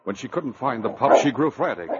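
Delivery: normal talking voice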